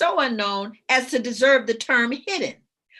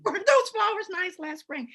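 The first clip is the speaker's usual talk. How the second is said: light, airy line said higher; breezy small talk; 7 semitones higher than usual. Shown as a demonstration. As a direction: brush it off with cheerful chatter. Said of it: "everybody knows this is my line".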